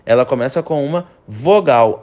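ordinary talk, nothing marked

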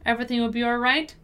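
brisk natural-speed read; fast, connected model sentence. Shown as a demonstration. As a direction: run the words together quickly. Teacher repeats at quicker pace.

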